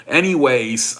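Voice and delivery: ordinary talk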